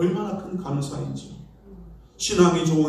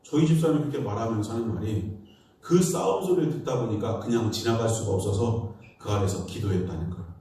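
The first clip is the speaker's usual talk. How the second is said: ordinary talk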